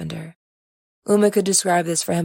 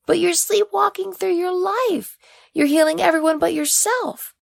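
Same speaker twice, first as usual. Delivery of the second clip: put-on much higher, earnest coaxing young man; Oriaku, eager and pushing; 12 semitones higher than usual. Oriaku urging Uma to live openly, eager high voice. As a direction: urge her to show herself, voice up high.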